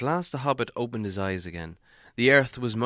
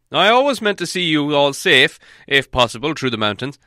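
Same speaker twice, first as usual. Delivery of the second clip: put-on high, loud and formal old wizard; Gandalf; 5 semitones higher, 9 dB louder than usual. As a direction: give a solemn ceremonial reply. Wizard addresses the party.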